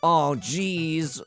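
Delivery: ordinary talk, nothing marked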